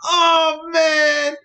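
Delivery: desperate shouting. Real, not acted. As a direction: scream at the screen, then lose it. Losing gamble, yelling at screen.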